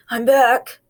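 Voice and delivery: a husky rough voice. A narrator's reading. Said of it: "he said in a husky voice".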